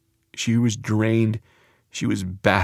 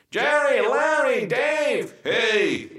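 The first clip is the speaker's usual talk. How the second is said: high sing-song greetings, much higher; mock calling out; 13 semitones higher, 3 dB quieter, much slower than usual. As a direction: call out the names like the mayor of the ER. Calling out to coworkers.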